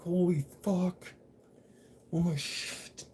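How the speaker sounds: high, slow, drawn-out horror; imitating his own horrified reaction; stunned dismay. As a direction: let it out as the charges are read. Hearing nine assault charges.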